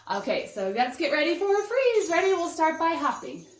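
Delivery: put-on rally the kids for the freeze game; excited call. Teacher rallying toddlers to play freeze, excited call.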